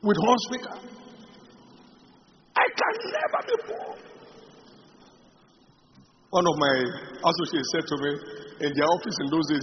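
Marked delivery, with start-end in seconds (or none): 2.6-4.0 high stretched cry on never; retold scream. Preacher reliving his shout, high stretched cry. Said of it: "I started screaming it".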